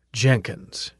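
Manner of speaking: quiet, disgusted repeat of Jenkins; distasteful. A narrator's reading. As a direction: say the name as if it tastes bad. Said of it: "as if the word were somehow distasteful".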